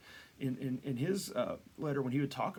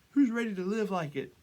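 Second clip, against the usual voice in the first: high, louder acted call-out; rallying challenge; 7 semitones higher, 6 dB louder than usual. Leader challenging cheering believers, high acted call. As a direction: throw the challenge to the crowd.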